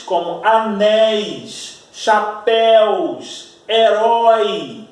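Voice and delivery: "anéis", "chapéus", "herói" drawn out very slowly with the open éi, éu, ói sounds held wide; teacher stretches "anéis", "chapéus", "herói" so the vowels ring open; open diphthongs stretched. Shown as a demonstration. Teacher to class, showing open diphthongs, slow "anéis", "chapéus", "herói". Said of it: "a gente pronuncia aberto".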